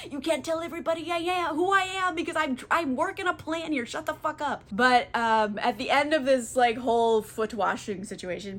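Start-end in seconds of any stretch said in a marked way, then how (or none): 0.1-4.6 high, urgent mock scolding; frantic hushing. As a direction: act out panicked secrecy. Odysseus frantically silencing the nurse.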